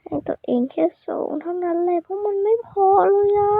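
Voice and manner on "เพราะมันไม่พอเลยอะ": whining. Pretend. Singer moaning to viewers about his own score, whiny wail. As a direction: whine it high as if about to cry. Mock-weeping over own poor singing.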